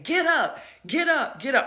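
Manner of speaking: high mock yell; imitated yelling. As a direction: bark it across the cafeteria.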